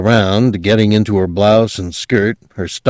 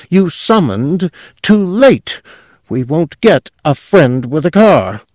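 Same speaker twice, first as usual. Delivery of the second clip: despairing; 8 semitones higher, 3 dB louder than usual. A narrator's reading. Clerk glazed despair.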